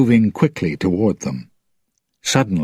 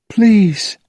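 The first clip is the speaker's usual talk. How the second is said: high, pleading cry; desperate high plea; 12 semitones higher, 4 dB louder than usual. A narrator's reading. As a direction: beg him, voice rising high. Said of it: "she cried".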